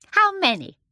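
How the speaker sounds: put-on high, coaxing little old lady; sweet old shopkeeper. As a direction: invite the children in a sweet high voice. Shopkeeper asks customers.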